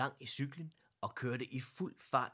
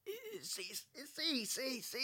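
squeaky, much higher stammering; frightened stammer; slower than usual. A narrator's reading. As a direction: stutter in fright. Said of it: "stammede Eddie".

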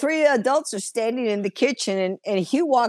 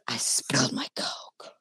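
put-on whispered toddler quote; 12 semitones lower, 8 dB quieter than usual. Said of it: "And he goes".

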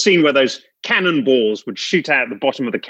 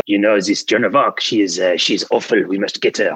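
put-on French accent; 3 semitones lower than usual. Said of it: "the accent's just a little off".

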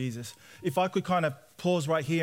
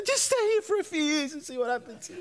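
much higher, casual mum voice; quoting mum; 12 semitones higher than usual. Retold or imitated. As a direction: mimic mum breezily in a high voice. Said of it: "And she goes".

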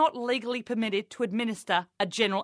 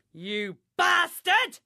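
mock outburst; 6 semitones higher, much slower than usual. Outburst inside quoted lament.

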